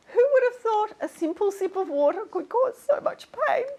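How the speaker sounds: high, weepy mock sorrow; tearful lament. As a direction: lament theatrically, close to tears. Still weepy over fan's tragedy.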